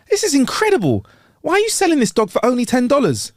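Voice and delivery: high astonished question; amazed. Acted. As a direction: play the stunned buyer. Acted amazed man in joke.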